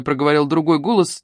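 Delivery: plain reading voice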